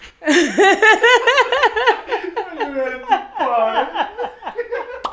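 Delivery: high burst of real laughter; shrieking laugh; big laugh. Real, not acted. Host laughs at quote.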